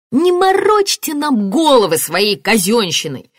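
slow, high, exasperated rebuke; irritated. A narrator's reading. Martin to detective about official jargon, irritated. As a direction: cut through the jargon impatiently.

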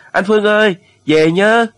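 sudden high, loud farewell yell. A narrator's reading. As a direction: cry out after a departing friend. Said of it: "Tiếng thằng Mạnh bỗng gào lên". (gào to gọi với theo, giọng vút cao)

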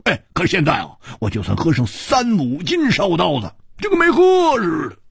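put-on high, bragging drunkard; high boastful swordsman; Hu Tiehua's voice. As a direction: boast like a loud old drinker. Hu Tiehua boasting about drinking.

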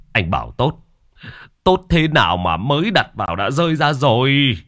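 still annoyed. A narrator's reading. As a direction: throw the claim back at the seller. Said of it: "Ông Cảnh vẫn còn bực". (bực bội vặn lại)

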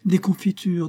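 put-on a child's glad shout; excited cry. A narrator's reading. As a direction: call out the idea with delight. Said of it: "s'écria Adèle".

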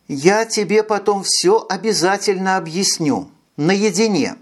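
coaxing, high and sweet; ingratiating. A narrator's reading. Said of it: "вкратчиво сказала ведьма".

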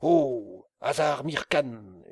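appel crié, plus fort, le nom étiré; hurlement. Acted. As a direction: hurler le nom du domestique à travers la cour. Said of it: "hurla-t-il à son domestique". (le mollah hurle après son domestique)

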